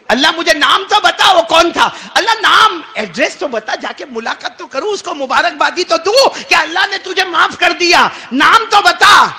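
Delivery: high, loud insistent demand; pleading shout. Acted. Moses begs God for the sinner's name, shouting. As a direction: beg for the name at full voice.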